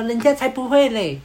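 high-pitched imitation of a girl protesting; girl's retort. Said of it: "然后妹子就说".

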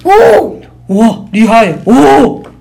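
响亮地惊叹おー; 佩服地大声惊叹; impressed oh. Acted. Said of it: "それはすごいね". (赞叹地说おー)

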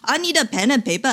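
I need a pen and paper said in a light Indian English lilt with a rising end; imitating an Indian senior colleague's English accent. To the audience, imitating an Indian MD colleague, Indian English accent. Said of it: "很M D".

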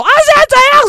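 high, loud picking-a-fight yell; imitated aggressive challenge; mock confrontation. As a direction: burst out as if spoiling for a fight. Mock confrontational opening mother didn't use.

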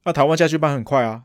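normal talking voice

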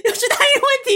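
high-pitched giggling words; laughing. Real, not acted. Laughing at hotline menu.

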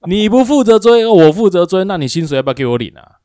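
loud, high scolding that speeds up at the end; heated retort. Retold or imitated. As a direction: hammer the coworker with the point. Scolding the coworker in retelling. (对同事大声高调地反驳)

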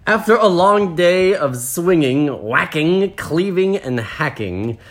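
high, slow list settling down; story narration. A narrator's reading. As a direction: savour each verb in storybook style. Reads the story aloud.

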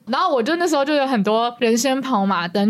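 ordinary talk, nothing marked

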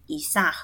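以撒 with a lightly breathed final h. Shown as a demonstration. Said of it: "就是有点轻轻的发音".

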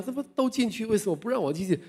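put-on old man arguing at the gate of heaven, indignant; angry and anxious. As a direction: demand to be let in. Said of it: "他就很生气啊，很急啊".

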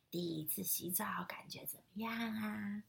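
soft unvoiced question; whispering. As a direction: whisper it kindly. Grandma asks softly. (轻声问)

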